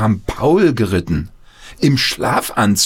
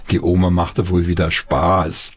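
ordinary delivery, nothing marked

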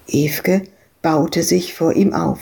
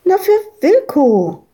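put-on high, bright child voice, as if it's obvious; little girl; 11 semitones higher, 3 dB louder than usual. Madita tells Ewke she means the dog Wilko, high child voice.

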